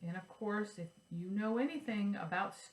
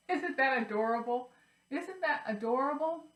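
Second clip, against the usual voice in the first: excited; 6 semitones higher, 5 dB louder than usual. Real, not acted. Gushing over the rhyme.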